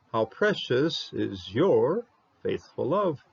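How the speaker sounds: normal talking voice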